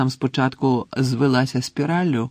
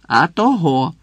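louder, mildly snappy comeback; snappish retort; 3 semitones higher, 6 dB louder, slower than usual. A narrator's reading. The portrait lady retorts to Harry, snappish. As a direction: answer back curtly, a touch irritated. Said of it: "огризнулася гладка пані".

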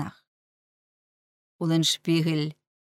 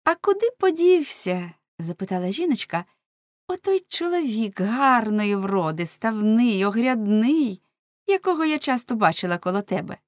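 high, louder inquisitive woman; жіночка-співрозмовниця; 5 semitones higher, 4 dB louder than usual. Acted. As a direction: ask in a raised woman's voice. (допитлива жіночка, високий голос)

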